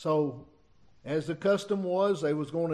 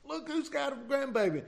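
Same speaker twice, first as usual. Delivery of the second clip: mock holler to someone across the house; hollered call; 8 semitones higher, 3 dB quieter than usual. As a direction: call out the news in a raised pitch. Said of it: "I hollered out".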